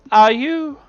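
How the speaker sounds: high, loud concerned voice; Yolanda's voice. Acted. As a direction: ask brightly as Yolanda.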